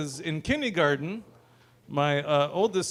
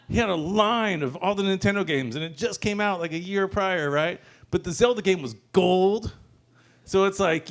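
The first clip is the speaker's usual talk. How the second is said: ordinary talk, nothing marked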